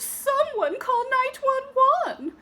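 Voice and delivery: very high frantic call; panicked shouting. Acted. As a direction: shriek for someone to phone. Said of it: "cried Madame Gertrude".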